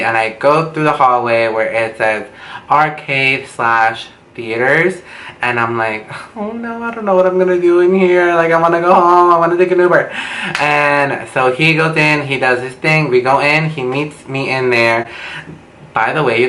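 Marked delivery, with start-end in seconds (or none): 6.4-10.1 higher, whiny panic, wanting out; panicked whine. Retold or imitated. Inside the sleazy theater wishing to leave, high panicked whine. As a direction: plead to go home like a scared kid.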